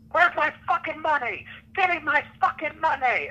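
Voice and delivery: high-pitched mock yell, no extra volume; imitated shouting. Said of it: "just shouting".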